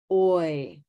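usual speaking voice

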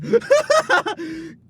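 a short burst of laughter. Real, not acted. Laughing at own joke.

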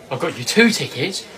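ordinary talk

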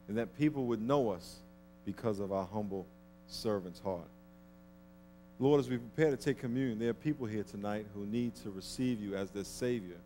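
ordinary talk, nothing marked

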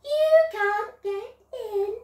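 high, teasing piglet voice; sing-song it to tease the wolf; taunting piglets. Acted.

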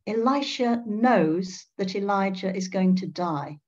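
ordinary delivery

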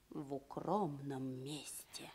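slow hushed whisper; secret whisper. Acted. Adviser whispers to the princess where they should meet, hushed. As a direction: lean in and whisper the place.

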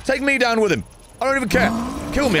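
high, whiny plea to be killed; begging the game to end it, voice up high; mock despair. Real, not acted. Streamer despairs at the boss fight.

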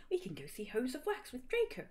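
mock-giggly suggestion; giggled. A narrator's reading. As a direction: chirp it girlishly, without real laughter. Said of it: "giggled Vampire".

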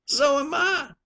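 high, loud wail of misery; whining dying complaint. Acted. Harry wails to the skipper, high and pitiful. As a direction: moan it like a man at death's door. Sick sailor claims he is dying.

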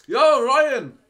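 shouted greeting, high and loud; calling out. Real, not acted. Streamer spotting Ryan in chat, loud shouted greeting. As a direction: hail a friend across the room.

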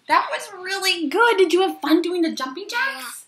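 put-on high, loud, bubbly kids host; overbright kids party host. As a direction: pump up the kids at home.

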